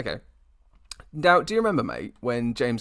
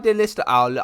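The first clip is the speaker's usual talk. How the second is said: usual speaking voice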